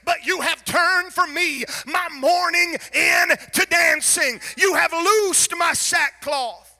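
loud, high, slowing shouted preaching; climactic preaching. Acted. Preacher proclaiming mourning turned to dancing, shouting. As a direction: lift the church with joy.

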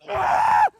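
startled shout. Retold or imitated. Shoving in the mosh pit.

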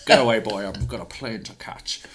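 a hint of a dismissive old Scot; Sean Connery voice. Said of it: "Sean looks at him and goes".

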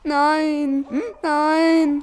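mild whimper. Acted. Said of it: "jammerte sie".

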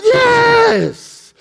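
very high, long-held cheer; crowd's shout. Acted. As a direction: imitate a roaring crowd answering. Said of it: "And the crowd shouted".

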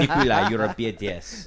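laughing, loud mock Russian accent. Teasing his Russian-looking friend Nikolai, in a mock Russian accent. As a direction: put on a joking Russian accent. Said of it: "the best accent you've done so far".

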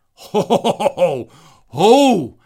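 loud hearty ho ho ho laugh; big booming laugh. Real, not acted. Laughing hard at his brother's flustered reaction, a loud booming laugh. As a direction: burst out laughing loudly, high and booming.

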